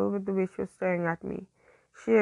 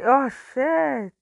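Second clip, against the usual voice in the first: louder, higher exasperated curse; exasperated outburst after fumbling a line; real frustration; 5 semitones higher, 5 dB louder than usual. Real, not acted. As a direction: swear at your own slip. Reader fumbles the name, swears.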